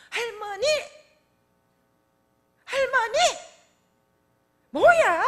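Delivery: put-on high, loud sulky child calling; shrill-free childish high yell for grandma. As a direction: play the upset grandchild shouting for grandma. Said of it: "애가 막 연출을 하는데 표정도요".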